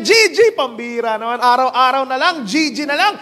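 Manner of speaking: high, loud griping with a laugh; exasperated worker. Acted. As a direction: gripe half-laughing about the fish. Worker three complaining, laughing.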